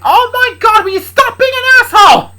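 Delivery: high, loud exasperated scream; angry. Acted. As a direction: shriek angrily at your dad. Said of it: "Luna said angrily".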